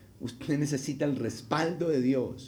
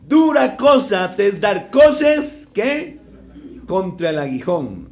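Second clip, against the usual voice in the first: loud, high, drawn-out divine proclamation; thundering preacher quoting Christ; voice of Jesus; 7 semitones higher, 11 dB louder, slower than usual. Acted. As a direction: boom it out slowly as the Lord from heaven. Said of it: "cuando el Señor le dice".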